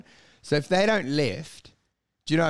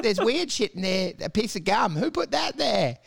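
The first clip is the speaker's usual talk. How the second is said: uplifting stage comic; 7 semitones higher than usual. Retold or imitated. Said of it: "jumping around the stage going".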